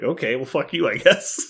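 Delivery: puzzled retort climbing up at the end; retold miffed reaction. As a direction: act out being put off by a message. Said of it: "accidentally hitting question mark versus exclamation point".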